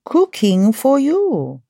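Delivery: hammed-up French chef; French accent. Chef Smiley welcomes listeners into the kitchen, playful French accent. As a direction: play a French chef with drawn-out words. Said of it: "to keep up my French accent".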